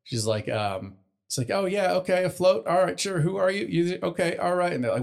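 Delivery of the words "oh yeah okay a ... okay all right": high, dazed and unsure; bewildered girly voice. Acted. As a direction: play along, confused. Overwhelmed filly voice.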